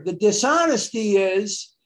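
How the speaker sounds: ordinary delivery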